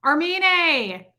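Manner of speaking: high, drawn-out greeting; delighted roll-call shout; excited name call. Real, not acted. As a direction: call the name out high, holding the last syllable. Calling out viewers in roll call.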